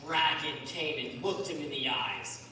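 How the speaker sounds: ordinary talk, nothing marked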